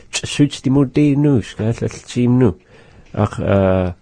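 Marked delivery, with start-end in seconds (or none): 0.1-2.6 louder demo. Shown as a demonstration. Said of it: "be a little louder".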